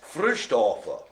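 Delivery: slow, mock German or Austrian accent on Frühstoffer; German accent attempt. Shown as a demonstration. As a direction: try a German accent, drawn out. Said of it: "trying to do a bit of German or Austrian".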